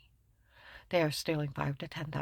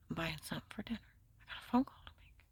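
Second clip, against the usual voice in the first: whispered reply; 10 dB quieter, faster than usual. Retold or imitated. Her own quoted reply, whispered.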